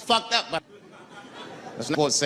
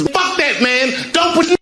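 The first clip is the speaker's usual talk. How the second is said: high, loud protest; high-pitched shouted objection; acted outburst; 14 semitones higher, 5 dB louder than usual. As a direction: yell it out in alarmed objection.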